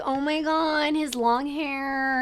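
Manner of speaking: gushing friend. Retold or imitated. Friend swooning over pictures of the crush, gushing imitation.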